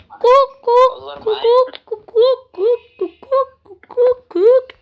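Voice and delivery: high-pitched drawn-out clucks; chicken clucking. Pretend.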